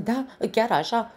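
high, loud call; shouting rabbit. A narrator's reading. Rabbit agreeing with the mouse, shouting high. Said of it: "strigă un iepure săritor".

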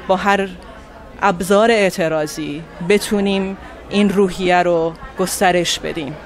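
usual speaking voice

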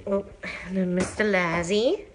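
usual speaking voice

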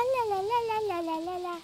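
ordinary delivery

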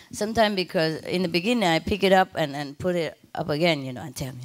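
ordinary talk

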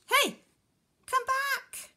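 high, loud shout; shark's high-pitched holler; calling after someone. Acted. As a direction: yell after the fleeing fish. Shark calls after fleeing fish.

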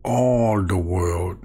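normal talking voice

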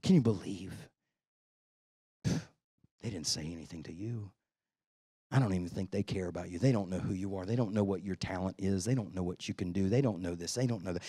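low, soft, insinuating voice of the demon; a devil murmuring lies, low and hushed; tempter's voice. Retold or imitated. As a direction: whisper doubt into his ear, pitched low. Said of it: "I want you to hear this language".

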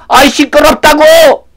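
high, irritated outburst; exasperated yell. Acted. Townsman to noisy blind man, exasperated yell. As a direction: yell to shut him up. Crowd yelling at him to hush.

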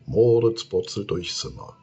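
usual speaking voice

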